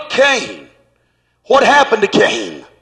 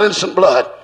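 a low, dark cry of innocent blood; low devil's cry; 6 semitones lower than usual. Retold or imitated. Preacher voicing the devil crying out, low. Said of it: "That was the devil crying out".

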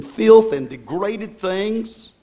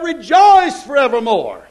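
Rejoice forevermore proclaimed high, falling firmly; preached scripture; 5 semitones higher, 6 dB louder, slower than usual. Acted. Preacher quoting the Bible to his congregation, proclaiming.

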